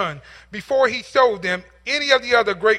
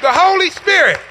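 The Holy Spirit cried out high and loud; loud proclamation; 7 semitones higher, 6 dB louder than usual. Shown as a demonstration. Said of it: "repeated, loud then soft".

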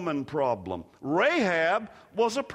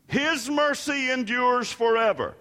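raised, higher call to the congregation; 7 semitones higher, 6 dB louder than usual. Real, not acted. Preacher asks everybody to repeat after him, calling out. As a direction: proclaim it for a crowd to repeat.

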